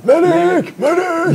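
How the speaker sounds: high, loud battlefield cry for a medic; man down shout. Pretend. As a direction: yell it like a wounded soldier. Game sound effect medic calls.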